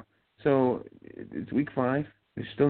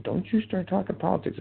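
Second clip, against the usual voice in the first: mock-scolding mother, pitched much higher; scolding mom; 11 semitones higher than usual. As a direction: wag a finger in a high motherly voice. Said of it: "My mom just said".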